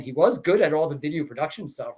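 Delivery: ordinary talk, nothing marked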